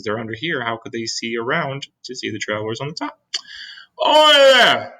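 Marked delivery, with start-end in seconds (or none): 4.0-4.9 high, loud shout from the police chief. Acted. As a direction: bellow it up at the peach. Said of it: "shouted the chief of police".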